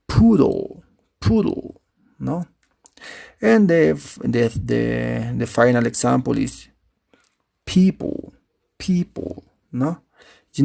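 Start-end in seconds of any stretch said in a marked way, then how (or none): 0.1-1.8 "poodle" with a high, long "oo", then falling; long first vowel. Shown as a demonstration. Teacher gives the correct pronunciation, long high first vowel. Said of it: "the correct pronunciation is". 7.7-9.4 "people" with a high, long "ee"; first-syllable stress. Shown as a demonstration. Teacher shows the final example, first vowel long and stressed. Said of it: "accented syllable is the first".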